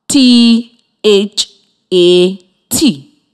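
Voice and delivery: T H A T letter by letter, slow and separate; spelling out. Shown as a demonstration. Teacher to young students, spelling each letter slowly. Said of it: "it is spelled".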